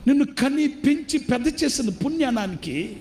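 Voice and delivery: high, impassioned sermon appeal; preaching at full force. Acted. Preacher appealing to the congregation, high and impassioned. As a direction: plead with the brothers from the pulpit.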